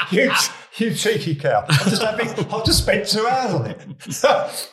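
the wife fuming about her hair; indignant wife. Retold or imitated. Wife's indignant reaction retold.

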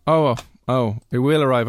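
ordinary delivery

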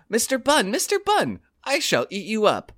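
high, sing-song hare voice; high, hungry storybook hare; trickster tale voices. Acted. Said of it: "The hare said to him".

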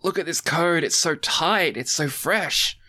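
high-pitched imitation of wound-up hackers; mock hacker enthusiasm, high and insistent; super tight hackers. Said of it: "everyone's, like, super tight, and they're like".

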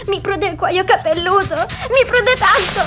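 panicking about itching skin; rising panic. Real, not acted. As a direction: voice climbing high, distressed. Patient distressed itching.

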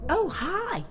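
a hint of a chirpy neighbour; lightly voiced neighbour. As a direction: play the neighbour, sweetly surprised. Neighbour answers the door.

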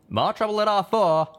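loud, high urgent warning. A narrator's reading. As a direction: call out the danger. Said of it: "Calamity warned".

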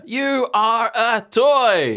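very slow, drawn-out "You are a toy"; solemn hammed-up film quote; dramatic movie line. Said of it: "That's a line from the film".

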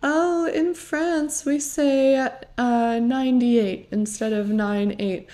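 imitating a condescending correction, high and drawn out at first; passive-aggressive. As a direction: correct them with mock-helpful condescension. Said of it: "says something kind of passive aggressive".

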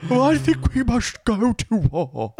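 put-on high, slow elderly stateswoman; old person voice. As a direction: speak like a frail old queen. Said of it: "talking like an old person".

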